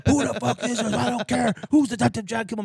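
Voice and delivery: high, laughing imitation of a furious drunk; high angry who-the-fuck while laughing; mock angry yell. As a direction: mimic the angry brother-in-law, cracking up. Angry brother-in-law on the phone.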